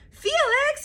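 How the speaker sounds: yelled. A narrator's reading. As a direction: mom yelling at her son over spilled cereal. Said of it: "yelled his mom".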